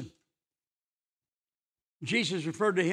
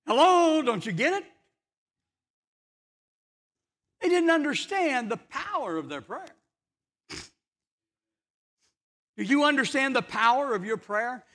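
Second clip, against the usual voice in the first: high, earnest pulpit voice; preaching; 7 semitones higher, 6 dB louder than usual. Acted. Preacher urging listeners that God answers, raised and earnest. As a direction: press it home to the congregation.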